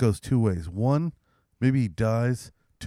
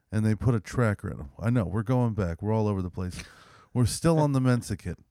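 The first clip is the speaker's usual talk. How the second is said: ordinary talk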